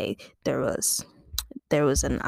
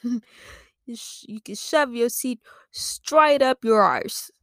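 slow, higher stage-Irish insult; bad Irish accent; 5 semitones higher, slower than usual. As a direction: draw out the mock-Irish put-down. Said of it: "the Irish lad guy said".

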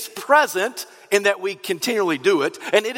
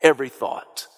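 full-force preaching; 6 semitones higher than usual. Acted. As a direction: drive the point home to the congregation.

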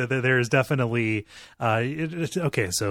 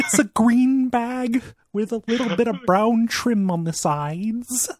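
high, slow nasal little frog; Kermit voice; 10 semitones higher, slower than usual. Acted. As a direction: answer like a timid frog. Answers in character.

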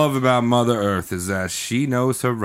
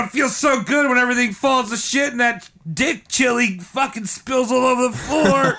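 mock Mother Teresa; 8 semitones higher than usual. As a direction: gloat like a greedy saint. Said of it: "She's like".

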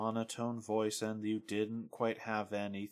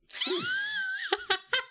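burst of laughter. Real, not acted. Laughs at the emo tag list.

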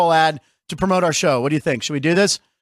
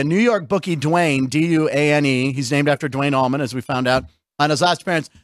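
ordinary talk

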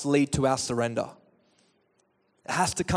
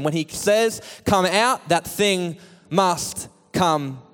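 higher-pitched, rhythmic sermon build; impassioned preaching; 4 semitones higher than usual. Real, not acted. Preacher tells every fear and anger to listen, impassioned preaching. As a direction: hammer out each item of the list with authority. Preacher lists what must obey.

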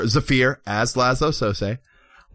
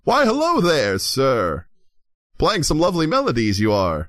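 Laszlo, courtly flatterer; 3 semitones higher than usual. Acted. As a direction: greet with theatrical politeness. Player approaches bard as Laszlo.

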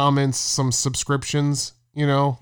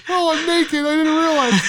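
high mock surprise; 10 semitones higher than usual. Neighbor caught naked.

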